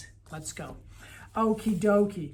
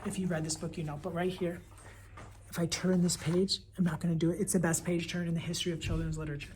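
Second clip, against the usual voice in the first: ordinary talk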